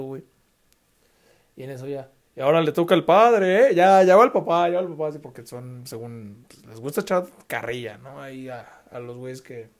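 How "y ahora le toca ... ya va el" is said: loud, booming host call to the room; emcee announcement. Retold or imitated. Party host calling the father up, booming announcement.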